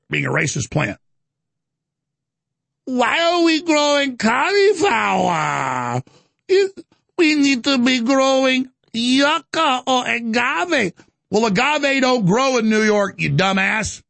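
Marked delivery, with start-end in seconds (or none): 2.8-10.9 slow, high whining mock lament; slow, high hammed-up whine mocking a politician. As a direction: whine it like a caricature. Mocking AOC's complaint.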